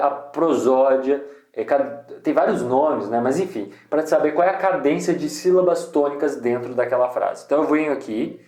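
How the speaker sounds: ordinary talk, nothing marked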